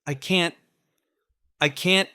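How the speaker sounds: despairing. A narrator's reading. Man reeling in despair.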